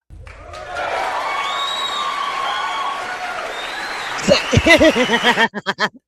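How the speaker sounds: high, loud delighted laughter; proud mom cracking up; long giddy laugh. Real, not acted. Laughing at own news.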